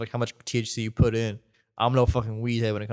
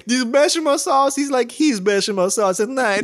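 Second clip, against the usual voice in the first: slow, high, loud mock Italian; hammed-up Italian accent through laughter; 12 semitones higher, 6 dB louder than usual. Italian waiter joking about bechamel sauce, slow mock Italian accent. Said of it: "that was an Italian accent".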